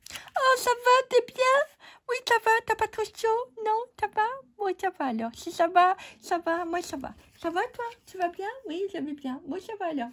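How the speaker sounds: squeaky make-believe chitchat; squeaky puppet voices. Pretend. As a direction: play both chatty little creatures. Two objects chatting in voices.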